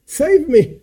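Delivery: high-pitched acted plea, falling at the end; mock drowning cry. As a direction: cry for rescue without really shouting. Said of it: "like I'm drowning".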